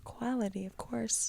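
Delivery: whispering. Real, not acted. Soft answer.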